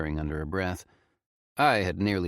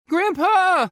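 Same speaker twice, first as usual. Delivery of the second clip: high, loud shriek; 10 dB louder than usual. Acted. Faye shrieks to her grandpa in the blizzard, loud. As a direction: scream it into the wind. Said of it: "Faye shrieked into the blizzard".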